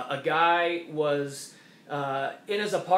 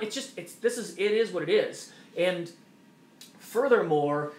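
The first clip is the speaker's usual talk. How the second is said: ordinary talk, nothing marked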